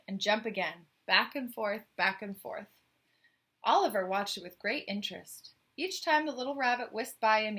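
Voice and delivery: story narration. A narrator's reading. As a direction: read the tale out to the listener. Reads the picture book aloud.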